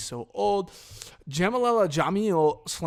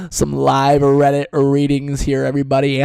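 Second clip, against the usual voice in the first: loud announcement; 11 dB louder than usual. Acted. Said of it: "announcing segment".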